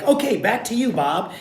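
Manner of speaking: high, bright handoff like a TV anchor; mock news anchor. Student in a ghillie suit playing field reporter, mock anchor handoff. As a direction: toss it back to the studio like a newscaster. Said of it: "And they're like".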